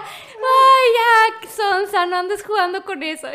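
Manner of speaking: a high, loud scolding mother who thinks it's a joke; mamá regañando. Retold or imitated. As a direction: scold her daughter as if she's kidding. Mamá regaña sin creerlo. (mamá regañando a su hija, aguda)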